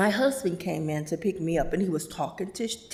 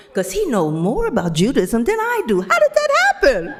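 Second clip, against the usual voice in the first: louder, soaring high disbelief; amazed exclamation; 6 semitones higher, 9 dB louder than usual. Retold or imitated. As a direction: marvel out loud. Sherry amazed about Ron.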